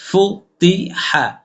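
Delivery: ফুতি হা drawn out very slowly and pressed; with emphasis. Shown as a demonstration. Teacher showing students the heavy pressed reading, slow. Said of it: "চাপ দিয়ে পড়তে হবে".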